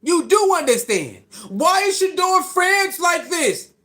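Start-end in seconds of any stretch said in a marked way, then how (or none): 1.5-3.7 drawn-out, high-pitched mock question; mock bewilderment. Commentator parroting critics of Shedeur's fans, mock bewilderment. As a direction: imitate a baffled critic, slow and high.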